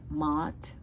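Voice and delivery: "matte" with a long open ah; "matte" with the vowel of father; own pronunciation of matte. Shown as a demonstration. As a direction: say "matte" like "maht". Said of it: "I call it matte".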